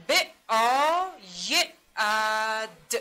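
باید sounded out slowly and loudly as ب, آ, ی, ا, د; sounding out. Shown as a demonstration. Teacher modelling the letters of باید, loudly sounded out. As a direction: stretch the vowels of باید, each sound apart. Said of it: "صدا کشی".

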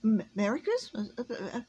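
stammered greeting through a put-on cough; gulp turned cough. A narrator's reading. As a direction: cover the shock with coughing. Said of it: "gulped, but quickly changed it to a cough".

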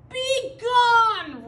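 big, high, drawn-out roar. A narrator's reading. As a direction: roar like a furious bear. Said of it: "roared Bear".

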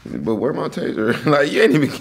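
real amused retelling; laughing loudly. Real, not acted. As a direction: talk through the giggles. Host cracking up, retelling.

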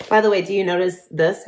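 ordinary delivery

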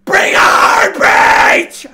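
huge yelled call, stretched out; shout. Real, not acted. Gamer yelling at the screen, full shout. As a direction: bellow it at full force.

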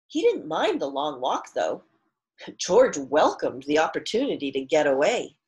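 clear, fuller read-aloud storytelling voice; story narration. A narrator's reading. Teacher reads picture book aloud.

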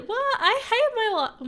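very high, loud tantrum wail; mad. Real, not acted. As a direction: wail in furious disbelief, half crying. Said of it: "I'm so mad".

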